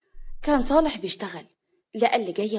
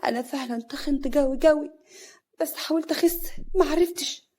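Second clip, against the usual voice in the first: higher, plaintive self-reproach; sad; 5 semitones higher than usual. Acted. Hana alone at the mirror, sad about her weight. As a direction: lament to herself, dismayed. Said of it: "هنا بتبص لنفسها في المراية بحزن".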